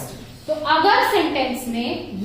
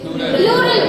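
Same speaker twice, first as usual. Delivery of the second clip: emphatic answer; 8 semitones higher, 4 dB louder than usual. Shown as a demonstration. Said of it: "teacher answers own quiz".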